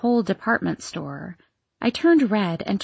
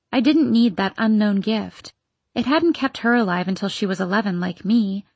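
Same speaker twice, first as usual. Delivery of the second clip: plain reading voice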